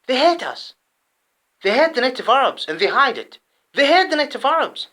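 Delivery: loud and high, hammering the word hate; rising anger, punching hate each time; outraged charge that they hate us; heated accusation. Real, not acted.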